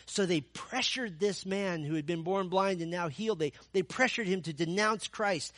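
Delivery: normal talking voice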